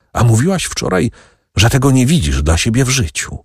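plain reading voice

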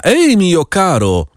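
high, slow call to the coachman. A narrator's reading. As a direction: call out Ej, mio caro, drawn out and high. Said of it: "krzyknął do pocztyliona".

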